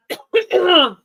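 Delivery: clearing a sore throat; rough throat clear. Real, not acted. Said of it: "sorry, I'm losing, losing my voice".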